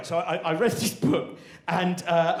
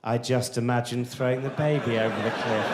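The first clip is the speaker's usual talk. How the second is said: low, measured, calmly menacing; sinister relish; 7 semitones lower than usual. Shown as a demonstration. Would-be killer musing about the baby, sinister. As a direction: savour it darkly, low and even. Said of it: "Very different thought, right?".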